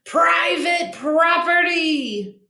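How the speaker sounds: slow, high grumpy yell. Acted. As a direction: bellow a warning, stretched out. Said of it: "yelled Hank".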